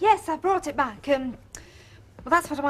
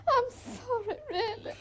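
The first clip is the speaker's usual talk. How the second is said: tearful; 6 semitones higher than usual. Acted. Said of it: "spoilt with tears".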